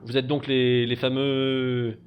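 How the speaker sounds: loud, hearty rich merchant; jovial old master. Acted. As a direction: boom out a warm welcome.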